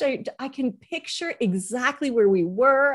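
ordinary talk

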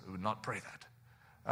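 low, very soft, slow reply; imitating a hushed, low-voiced objection; quiet refusal. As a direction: decline under your breath, slowly. Commander quietly declines the prayer.

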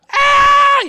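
loud, high-pitched mock scream; piercing imitation of a frightened scream; imitated scream. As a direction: shriek like someone terrified behind a door. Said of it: "She's screaming in there".

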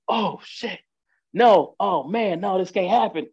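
high, panicked mock cry of alarm; retelling homeboy panicking at his shot friend, high alarmed; freaking out. Imitating a panicked high yelp. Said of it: "and he freaks out".

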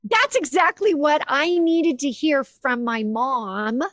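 overwhelmed. Real, not acted. Recalling moving words from reading.

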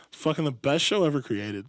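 ordinary talk, nothing marked

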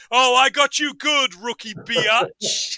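loud, high crowing that slows at the end; mock triumphant taunt; gloating prankster. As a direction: crow it loudly, drag out the last word. Acting out the prankster's gloating line.

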